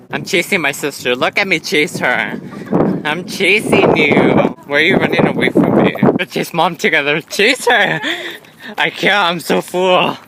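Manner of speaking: loud, excited running chatter; playful loud calling. Real, not acted. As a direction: call out while chasing. Chasing mom through the mall.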